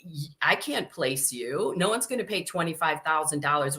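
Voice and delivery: usual speaking voice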